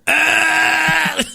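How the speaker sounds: loud, high retching yell; exaggerated high "Ah" of disgust; mock gagging cry. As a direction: imitate a child gagging, hammed-up. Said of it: "He started fucking gagging".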